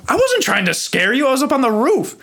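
high-pitched retold self-defense; indignant protest. Boy denies scaring his friend, indignant protest. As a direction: protest innocence, voice pushed up high. Defends himself against accusation.

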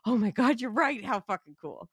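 excited realization. Retold or imitated. Fan realizing the opening continues, excited. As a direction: catch on and light up. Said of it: "And I'm like".

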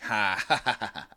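retelling how the neighbor laughed; mock laugh. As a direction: ham up a big laugh. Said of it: "throws his head back and laughs".

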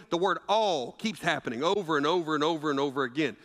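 ordinary talk, nothing marked

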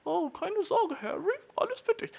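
put-on very high, sweet girly voice; Hermine. Hermine reassuring Harry in a very high, sweet voice. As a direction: squeak it out sweetly. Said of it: "und sie ist so".

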